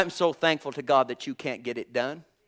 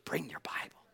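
whispered urging, much softer; 12 dB quieter than usual. Acted. Preacher quietly urging listeners to bring Bibles, whispered. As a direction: breathe it out as a hushed plea.

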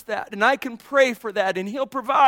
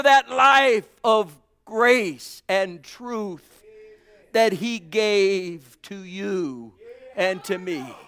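drawn-out, louder, lingering on each phrase; slow exalted praise; 3 semitones higher, 5 dB louder, slower than usual. Acted. Preacher leading praise before communion, slow and exalted. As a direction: pour out thanksgiving slowly.

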